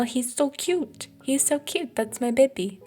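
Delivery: high-pitched doting coo; high, drawn-out adoring gush. Real, not acted. Gushing over a cute pet.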